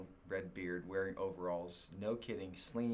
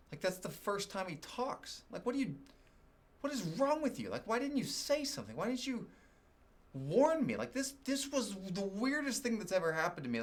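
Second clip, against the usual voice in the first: much higher, louder exasperated outburst; incredulous rant; 11 semitones higher, 3 dB louder than usual. Retold or imitated. Retelling his outrage that the farmer stayed silent, squeaking high with disbelief.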